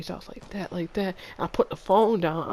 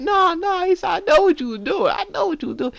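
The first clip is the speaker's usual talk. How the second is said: mocking brother; 5 dB louder than usual. Mocking brother, very high and loud.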